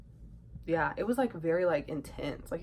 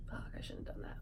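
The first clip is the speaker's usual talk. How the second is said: whispered regret; 11 dB quieter, faster than usual. Retold or imitated. Regret after doing something.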